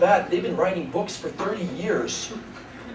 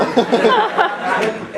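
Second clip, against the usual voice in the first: ordinary talk, nothing marked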